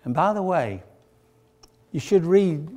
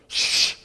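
sharp "shh" to silence someone; hushing. Acted. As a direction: shush a man who is hollering. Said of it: "Don't be hollering like that".

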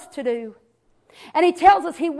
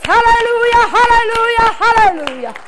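hallelujah call; 5 semitones higher, 3 dB louder than usual. Real, not acted. Preacher exclaims praise.

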